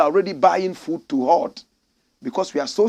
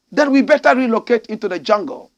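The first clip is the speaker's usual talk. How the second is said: ordinary delivery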